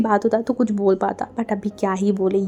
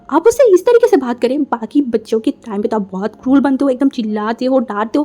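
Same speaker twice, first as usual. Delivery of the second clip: shocked indignation; 2 semitones higher, 5 dB louder than usual. Acted. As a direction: challenge him in disbelief. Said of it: "shockingly देखा".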